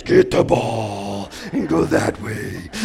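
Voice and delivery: slow, strained, loud holler; mock coach yell. Coach losing his calm voice at the boys, yelling. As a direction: drag out each command in a hammed-up yell.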